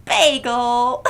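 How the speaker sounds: loud shout into a laugh; laughing call. Real, not acted. Storyteller shouting for Bagel then laughing. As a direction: yell the dog's name and crack up.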